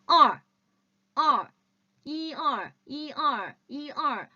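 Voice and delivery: 이, 얼 said as yi, er with a clear er; Chinese er demo. Shown as a demonstration. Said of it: "제가 들어보세요".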